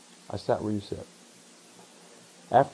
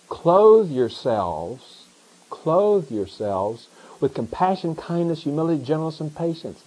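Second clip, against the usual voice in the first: slow emphatic reading; 3 semitones higher, slower than usual. Shown as a demonstration. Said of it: "reading the text slowly".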